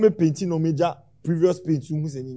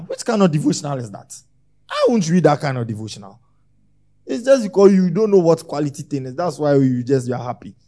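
normal talking voice

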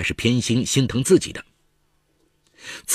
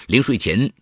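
ordinary delivery, nothing marked

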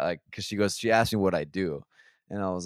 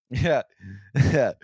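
laughing yeah; 6 semitones higher, 9 dB louder than usual. Real, not acted. Laughing at friend's liberal joke.

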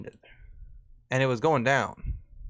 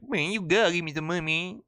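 put-on higher, fast Southern-accent beggar; 5 semitones higher than usual. Streamer redoes the beggar in a Southern accent. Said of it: "maybe I could do a southern voice".

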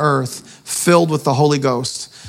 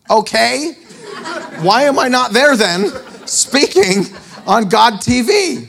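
high, slow, laughing disbelief; mock indignant protest; 8 semitones higher, slower than usual. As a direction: play your offended self, amused at it. Indignant retort to the Lord.

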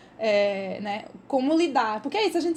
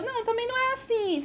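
gently calm him down, pitched up; reassuring; 9 semitones higher than usual. Retold or imitated. Reassuring friend about the camera.

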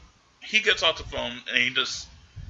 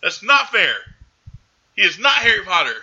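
higher, louder, indignant and laughing-off; loud protest; 12 semitones higher, 7 dB louder than usual. Real, not acted. Podcaster loudly protesting her cohost's Harry Potter joke. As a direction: push back hard, half laughing.

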